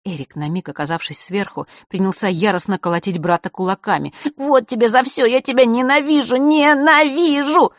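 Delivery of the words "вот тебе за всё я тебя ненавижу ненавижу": loud, high hateful yell, slowing; raging scream. A narrator's reading. Младший брат в бешенстве кричит на брата. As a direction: pour all the hatred out. Erik beating brother in rage.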